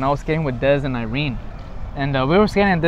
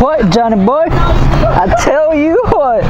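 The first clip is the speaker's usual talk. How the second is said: put-on playful caricature of a country good old boy; 9 semitones higher, 7 dB louder, much slower than usual. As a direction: ham up a good-old-boy twang, stretching every word. Put-on redneck voice bit.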